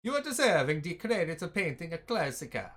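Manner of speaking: loud, hammed-up Italian accent; mock Italian accent. Gamer voicing the painting teacher NPC in a hammed-up Italian accent. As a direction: lay the Italian on thick for a laugh. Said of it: "That's my Italian".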